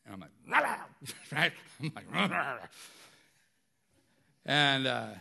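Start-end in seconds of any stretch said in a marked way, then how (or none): 0.5-0.8 short wordless mock bark; retold angry bark. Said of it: "I barked at him". 2.1-2.7 wordless mock barking, run together; retold angry bark. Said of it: "I barked at him".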